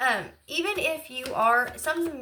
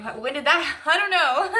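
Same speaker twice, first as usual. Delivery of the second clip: higher and louder, breaking into a laugh; laughing at herself; 5 semitones higher, 4 dB louder than usual. Real, not acted. To viewers about her vision, laughing. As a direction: giggle through the words.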